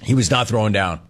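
ordinary delivery

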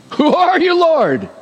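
Paul crying out in shock; bewildered cry. Acted. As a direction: cry out to a blinding light, lost and startled.

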